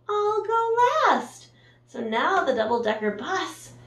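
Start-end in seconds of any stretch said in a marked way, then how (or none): 0.1-1.5 polite bus. Acted. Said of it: "The double-decker bus said".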